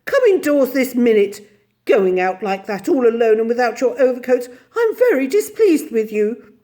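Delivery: loud, stern telling-off; displeased, insistent lady; scolding. A narrator's reading. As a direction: scold a wayward pet. Miss Lark scolds Andrew.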